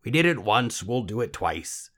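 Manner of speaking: a hint of the croaky helper making his offer; little man. A narrator's reading.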